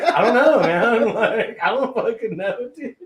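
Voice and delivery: loud, high, cracking up mid-quote; can barely get the words out for laughing; laughing through it. Retold or imitated. Telling a friend about the angry eyebrows, laughing hard. His own reply retold while laughing.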